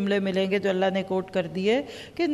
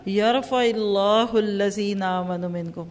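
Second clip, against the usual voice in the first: Quran recitation. Acted.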